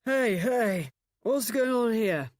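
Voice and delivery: put-on loud, slow drunken slurring; drunken slur. A narrator's reading. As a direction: slur it like a man who's had far too many. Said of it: "he slurred".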